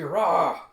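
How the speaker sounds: short, loud beast roar; attack roar. A narrator's reading. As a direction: let out a fierce attacking roar. Attacker roars in battle.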